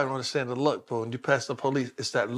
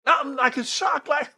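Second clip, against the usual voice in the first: rising indignation; 11 semitones higher, 6 dB louder than usual. Retold or imitated. Confronting the cop, rising shock.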